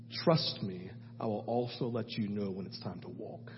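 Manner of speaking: hushed earnest testimony; sober reflection. Real, not acted. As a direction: draw listeners in close. Quiet reflective confession.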